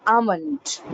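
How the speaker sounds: "almond" slowly, L left silent; slow AH-mund for "almond"; "almond" with no L, drawn out; corrected pronunciation. Shown as a demonstration. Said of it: "It's almond".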